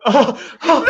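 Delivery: high, loud hammed-up gasping cries; overcome movie viewer. Viewer watching him make the jump, hammed-up overwhelmed. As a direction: play overwhelmed, loud and high, half laughing. Acting out his reaction to jump scene.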